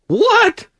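high squeaked surprise; a high, incredulous little yelp; mock shock. Host retelling hearing his friend come out, mock shocked.

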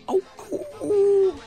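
high hooting oh sounds; owl hoot imitation. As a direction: hoot like Sawtell owls. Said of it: "they go".

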